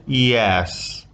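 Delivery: "Yes" drawn out with moving pitch; "Yes" gliding into a fall. Shown as a demonstration. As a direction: let the pitch move through a long "Yes". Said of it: "behavior of the pitch that rises and falls".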